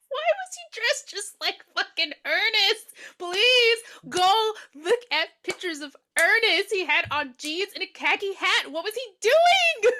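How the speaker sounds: high-pitched, loud incredulous rant; exasperated outburst. Real, not acted. Ranting to co-host about the killer dressed like Ernest, exasperated. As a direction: exclaim it high, stretching the key words.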